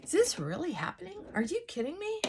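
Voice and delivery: stunned at the news; disbelief. Retold or imitated. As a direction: voice shooting up in disbelief. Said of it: "I was like".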